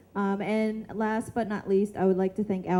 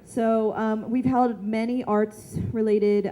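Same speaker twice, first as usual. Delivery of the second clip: ordinary talk, nothing marked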